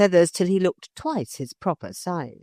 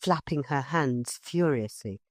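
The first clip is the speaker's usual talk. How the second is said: ordinary delivery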